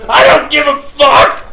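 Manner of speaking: high, loud shout; re-enacted yell of defiance; furious retort. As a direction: explode back at the neighbor. Acted retort to complaining neighbor.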